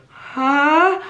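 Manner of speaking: high HUH swooping up; wrong upward intonation. Shown as a demonstration. Showing students the needy tune, high rising HUH. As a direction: HUH rising instead of going down. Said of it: "gotta go down instead of".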